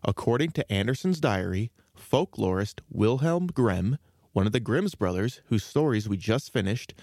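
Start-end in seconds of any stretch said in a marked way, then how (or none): none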